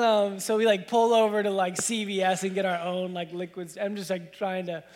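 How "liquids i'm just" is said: ordinary talk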